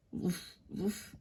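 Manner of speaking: low, soft pretend woofs; dog barking. Thirsty dog woofs beside its owner, soft low pretend barking. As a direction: imitate a dog with small, low barks.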